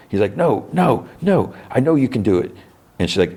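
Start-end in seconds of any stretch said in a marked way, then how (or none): 0.4-2.6 pitched high, a begging no no no; desperate plea. Retold or imitated. Alexei begging his daughter Yelena not to stay, pleading high and desperate. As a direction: plead like a frantic father.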